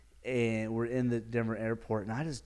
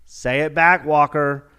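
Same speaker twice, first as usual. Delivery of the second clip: loud, higher-pitched shout; raised voice, pressing hard for an answer; insistent demand; 4 semitones higher, 12 dB louder, slower than usual. Acted. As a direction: holler the demand down at someone. Repeats the demand, louder.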